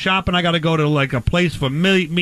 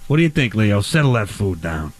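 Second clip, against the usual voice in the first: much lower, slowed, laughing mock growl; 9 semitones lower than usual. Host tells the dog to settle its food, low mock voice.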